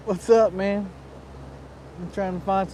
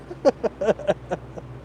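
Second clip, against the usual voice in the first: breathy laugh; 3 semitones higher, 5 dB quieter than usual. Real, not acted. Laughs after cheering.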